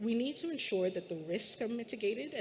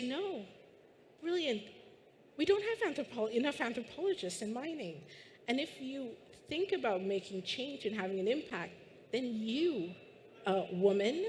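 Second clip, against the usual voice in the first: put-on half-hearted posh English accent, pitched up; posh British accent; 4 semitones higher than usual. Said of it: "I can't do it, but imagine that accent".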